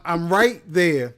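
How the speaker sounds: ordinary talk, nothing marked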